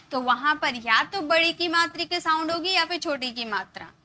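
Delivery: ordinary talk, nothing marked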